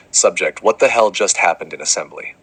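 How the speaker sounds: normal talking voice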